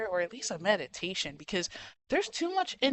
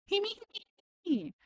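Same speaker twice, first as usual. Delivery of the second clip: high-pitched mocking whimper; higher, whiny mock sob; mock crying whine; 12 semitones higher, 5 dB quieter than usual. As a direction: jump way up and whine it like a crybaby. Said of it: "I can't freaking do the high pitch".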